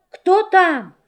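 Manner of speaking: high small voice; little goats asking; kid goat voice. Acted. Kids answer the knock.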